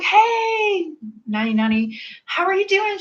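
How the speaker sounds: high, bright-eyed hello; greeting a friend at a stoplight, high and excited. Retold or imitated. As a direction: gush a happy hello.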